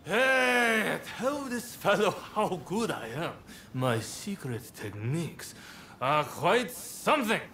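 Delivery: put-on slurred, swaggering drunk bragging; drunken boasting. Drunk man pestering a stranger about his techniques, slurred swagger. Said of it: "He's three sheets to the wind".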